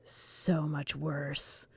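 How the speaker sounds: slow, hushed, ominous; ominous warning. Pretend. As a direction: tease them with dread, slowly. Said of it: "oh, you think this is bad?".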